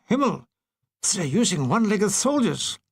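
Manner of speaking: put-on puzzled German officer accent. German officer watching through binoculars, German accent. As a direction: mutter it like a baffled German officer.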